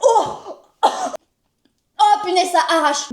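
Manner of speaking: shocked cry at the heat; burning mouth. Real, not acted. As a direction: react to fiery sauce. Burning hot sauce reaction.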